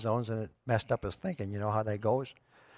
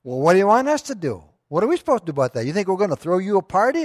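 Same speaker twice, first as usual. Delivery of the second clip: raised, louder mocking retort; sarcastic; 6 semitones higher, 6 dB louder, faster than usual. Prostitute mocking Agnes about her birthday, loud sarcastic retort. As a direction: sneer at the birthday news.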